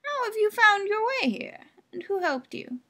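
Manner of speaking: put-on very high, sharp old crone; old woman. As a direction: quiz her like a suspicious old woman.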